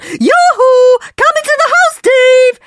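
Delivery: high, loud calling yoo-hoo. Acted. Mother calling her son Dave into the house, loud high call. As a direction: call the boys in from far off. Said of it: "when she wants one and calls out".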